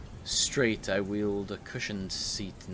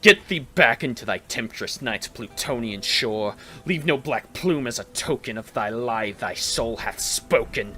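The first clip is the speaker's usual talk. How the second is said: loud, angry ordering; furious command; 4 semitones higher, 12 dB louder than usual. A narrator's reading. Narrator angrily ordering the raven to leave. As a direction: drive the bird away with rage. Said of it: "I shrieked, upstarting".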